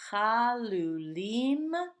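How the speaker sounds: chalulim read very slowly, drawn out; a slow teaching read of chalulim; slow and careful. Shown as a demonstration. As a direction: spell it out in sound, slowly. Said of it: "the two words the same as well".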